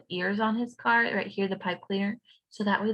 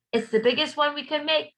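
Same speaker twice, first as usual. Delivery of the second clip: shout; 6 semitones higher than usual. A narrator's reading. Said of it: "they shout".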